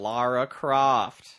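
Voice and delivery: slow mock American accent; bad American accent. Echoing the mocked Daniel Craig line in a bad American accent. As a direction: imitate a clumsy American accent. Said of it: "American accent that's so unconvincing".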